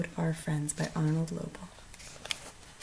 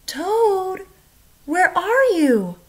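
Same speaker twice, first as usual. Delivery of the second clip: much higher, louder call into the dark; 13 semitones higher, 7 dB louder, much slower than usual. A narrator's reading. Frog calling into Toad's dark house, loud high call. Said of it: "called Frog".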